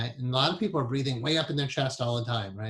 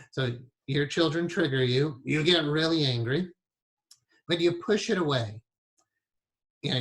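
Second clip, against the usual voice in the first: ordinary talk, nothing marked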